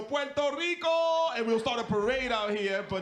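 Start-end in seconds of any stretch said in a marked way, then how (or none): 0.0-1.3 slow, proud Puerto Rico cry; mock rally cry. Comedian imagining a Brooklyn welcome, slow proud cry. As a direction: call it out like a parade. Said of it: "come to Brooklyn and go".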